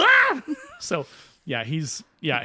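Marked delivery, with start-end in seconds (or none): 0.1-0.4 very high, loud shriek of fright; startled scream. Retold or imitated. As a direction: shriek in sudden terror. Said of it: "And she's like".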